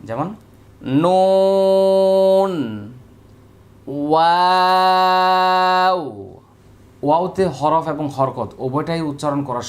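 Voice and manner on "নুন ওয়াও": "নুন" and "ওয়াও" drawn out slowly and loudly with the lips rounded. Shown as a demonstration. Teacher showing students rounded lips on নুন and ওয়াও, drawn out. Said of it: "ঠোঁট গোল করে উচ্চারণ করতে হবে".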